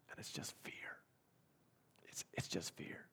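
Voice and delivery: breathy whisper, barely audible; hushed whisper. Acted. Preacher whispering to congregation about the family's fear. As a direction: drop to a whisper over the fear.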